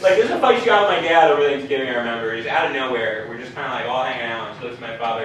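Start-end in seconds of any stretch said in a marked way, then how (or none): none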